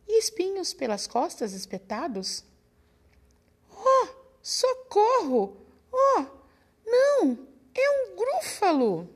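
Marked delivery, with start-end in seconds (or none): 3.7-9.1 much higher and louder, slow wailing panic; terrified cry. Acted. Mouse panicking at the sight of the gruffalo, high wail. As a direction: cry for help in mock terror, stretching each word.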